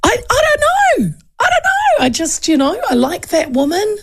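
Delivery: put-on squeaky high young girl, flustered and unsure; high-pitched mock of a confused young voter; young girl imitation. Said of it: "and she goes".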